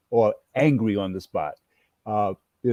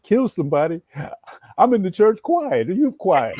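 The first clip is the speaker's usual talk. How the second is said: much higher and louder, squeaking in panic; alarmed inner voice; 10 semitones higher, 6 dB louder than usual. Retold or imitated. Choir boy's inner voice on hearing he must kill, panicked and high. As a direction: yelp it like a church-choir boy terrified of the idea. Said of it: "Inner voice is like".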